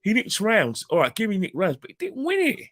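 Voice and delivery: high, loud exasperated protest; indignant outburst. Real, not acted. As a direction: let the outrage pour out. Indignant rant about decision.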